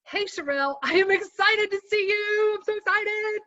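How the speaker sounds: high, bright-voiced, buoyant excitement; excited. Shown as a demonstration. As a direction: lift it way up with real excitement. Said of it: "But if I said to you".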